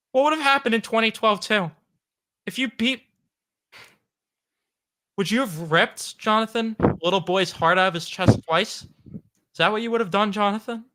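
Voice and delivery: high, wounded questioning; hammed-up heartbroken accusation; mock-emotional. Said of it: "I'm about to cry".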